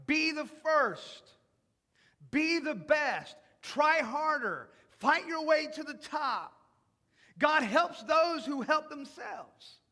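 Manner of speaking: slow, loud, pushy slogans. Retold or imitated. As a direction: hammer each slogan home slowly. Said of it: "this mentality that you've heard".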